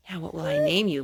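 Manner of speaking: plain reading voice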